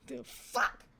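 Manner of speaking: sharp whispered what the fuck; startled aside; surprised. Real, not acted. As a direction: caught off guard mid-read. Reacting to her own misread.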